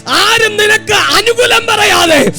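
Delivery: high, loud, shouted exhortation; full-force preaching. Real, not acted. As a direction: preach at a shout, pitched far above normal speech.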